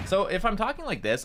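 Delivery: high-pitched, undeepened own voice; comfortable natural voice. Shown as a demonstration. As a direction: let the pitch rise to where it feels comfortable. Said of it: "me talking very comfortably".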